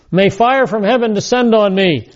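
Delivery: insistent self-cursing vow; sworn curse. Acted. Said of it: "he calls a curse down upon himself".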